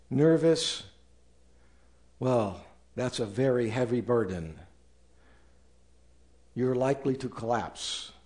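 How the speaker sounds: ordinary talk, nothing marked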